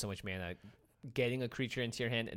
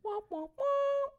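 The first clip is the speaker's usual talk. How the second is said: high, slow sad trombone; sad trombone womp; 4 dB louder, much slower than usual. Pretend. Sad trombone after big damage.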